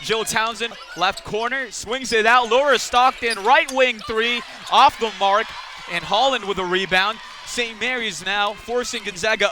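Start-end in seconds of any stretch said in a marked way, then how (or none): 1.7-7.2 loud, urgent play-by-play; excited shot call. Real, not acted. Commentator calls Stockton's missed three, excitedly. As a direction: build up the three-point attempt.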